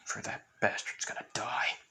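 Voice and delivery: hushed, whispered threat; whispered vow. Acted. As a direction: whisper it as a grim promise of revenge. Vow of revenge in told story.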